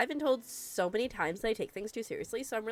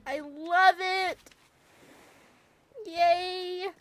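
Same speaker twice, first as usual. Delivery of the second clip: excited squeal; 10 semitones higher, 5 dB louder, much slower than usual. Real, not acted. Delighted at new building.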